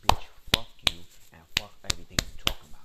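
loud, punched-out mock rejection; dismissive kiss-off. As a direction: voice a fed-up sign cutting someone off. Voices a dismissive Capricorn.

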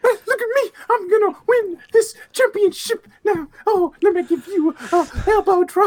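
extremely high, loud squeaky trash talk; hammed-up squealing wrestler; wrestling promo impression. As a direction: shout it in a tiny high voice. Joining the high wrestling impression bit.

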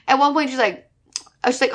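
ordinary delivery

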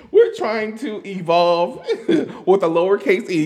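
giggling through the words; laughing at her own joke. Real, not acted. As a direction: laugh mid-sentence.

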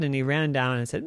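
plain reading voice

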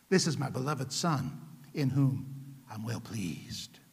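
low, hushed, solemn divine voice; slow, reverent scripture quote as God. Acted. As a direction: speak softly and deep, drawing out the last word. Voices God speaking of his Son.

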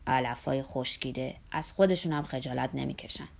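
low, insulting grumble; contemptuous mutter. A narrator's reading. Zorba grumbling at passengers' political talk, low and contemptuous. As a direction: grumble it with scorn, pitched well down.

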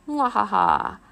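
loud, hammed-up villain laugh; evil cackle. A narrator's reading. Vampire mother cackles about her husband's bat lag. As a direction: throw back a booming mwahaha.

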